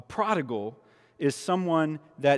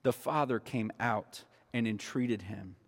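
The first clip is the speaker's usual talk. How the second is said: much softer, hushed repeat; 6 semitones lower, 10 dB quieter than usual. Shown as a demonstration. Pastor to congregation, hushed repeat. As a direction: let it land quietly. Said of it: "repeated softer".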